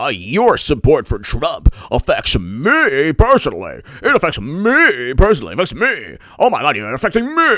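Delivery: high, rising, hammed-up whine; whiny mock outrage that goes shrill at the end; mocking whiny liberal. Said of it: "I would get this nonsense from people".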